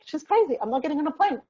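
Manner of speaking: high, loud indignant outburst; exasperated disbelief. Retold or imitated. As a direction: scoff it off as absurd. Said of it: "You're like".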